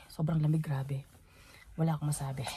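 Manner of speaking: cold whispered aside; whispering. Real, not acted. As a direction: whisper it, freezing. Freezing in the cold.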